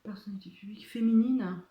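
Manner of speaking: breathy aside, reading off the card; whispered. Real, not acted. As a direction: whisper the question to yourself. Reading the question under her breath.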